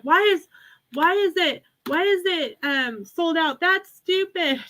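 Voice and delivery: whiny complainer. Retold or imitated. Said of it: "They're like".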